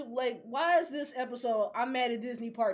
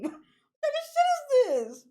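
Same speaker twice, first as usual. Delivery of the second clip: exasperated squeal; 12 semitones higher, 4 dB louder than usual. Real, not acted. Ranting about anime releases.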